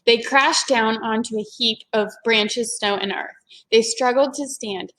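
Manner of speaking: ordinary delivery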